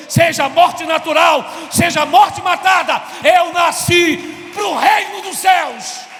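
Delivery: building to a full shout; preaching at full force. Real, not acted.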